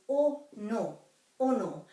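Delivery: o no with pure o sounds, not oh no; pure Māori o. Shown as a demonstration. Teacher models o no, pure vowels not oh no. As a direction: say o no without the English glide. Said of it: "the O is always o".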